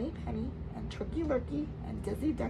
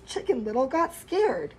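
ordinary delivery, nothing marked

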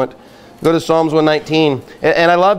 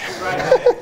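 quick breathy chuckle, high; a brief laugh at his own joke; short laugh; 3 dB quieter than usual. Real, not acted. Preacher laughs at own point.

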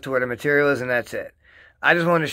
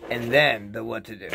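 ordinary delivery

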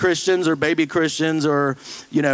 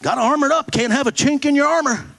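mock preachy reply; 10 semitones higher, faster than usual. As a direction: chirp it back like a pat answer. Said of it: "What does it mean".

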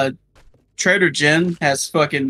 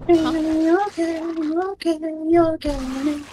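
soothing high voice; 12 semitones higher, slower than usual. Pretend. Mock soothing a character.

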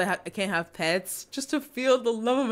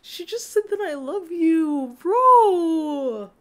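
high, slow, drawn-out wail; high emotional outburst over a confession; overwhelmed squeal; 9 semitones higher, 6 dB louder, much slower than usual. Real, not acted. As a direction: wail it out, ending loud on a long held word.

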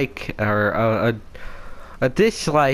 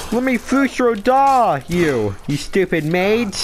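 taunting warrior; 7 semitones higher than usual. Acted. Taunting enemy mage.

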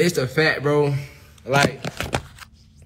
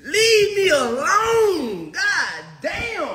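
exasperated shout; much slower than usual. Real, not acted. Exasperated at the iCloud login attempts.

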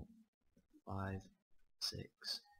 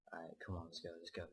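quiet; 4 semitones lower, 10 dB quieter, faster than usual. Real, not acted. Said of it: "Sorry, I'm going quiet".

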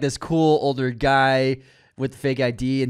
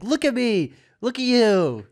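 high-pitched incredulous plea; hammed-up self-deprecating outburst; mock protest; 8 semitones higher than usual. Boy explaining why she wouldn't want him, mock exasperated.

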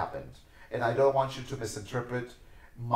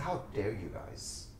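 stern reproach; 5 dB quieter, slower than usual. Retold or imitated. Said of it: "I said".

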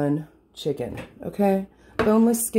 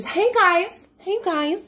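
much higher, chirpy mock YouTuber hey guys; mocking vlogger girl; 4 dB louder, slower than usual. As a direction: parody a bubbly influencer greeting. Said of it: "I sound like one of those stupid little bitches".

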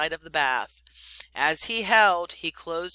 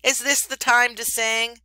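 angry; 6 semitones higher, 6 dB louder than usual. Acted. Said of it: "said Rikki Tikki angrily".

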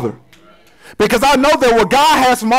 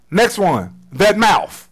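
usual speaking voice

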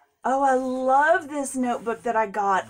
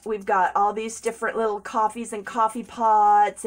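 usual speaking voice

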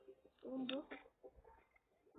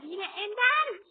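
drawn out, loud and higher; exasperated; 5 semitones higher, 12 dB louder, much slower than usual. Real, not acted. Gamer losing to Ender again, slow loud exasperated cry. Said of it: "Çıldırmak üzereyim".

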